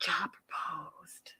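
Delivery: hushed secret whisper. Retold or imitated. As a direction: breathe it out like a secret. Sister whispering the news.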